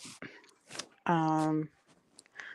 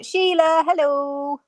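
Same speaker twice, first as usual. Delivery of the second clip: ordinary talk, nothing marked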